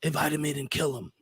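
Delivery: imitated whispering. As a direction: mimic a voice quietly egging her on. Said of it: "all the time he's whispering".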